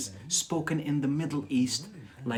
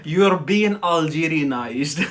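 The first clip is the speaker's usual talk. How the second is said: slow and laughing, louder; 7 dB louder, slower than usual. Real, not acted. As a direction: drawl it out laughing. Laughing tease.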